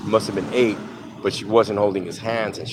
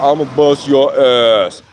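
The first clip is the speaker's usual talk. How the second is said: slow, loud cartoon threat, pitched up; Fat Albert voice; 5 semitones higher, 10 dB louder, slower than usual. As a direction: boom out a drawn-out threat as a cartoon character. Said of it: "he talks like, if you ever watch Fat Albert".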